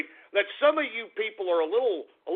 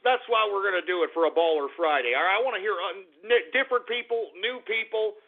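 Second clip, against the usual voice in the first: normal talking voice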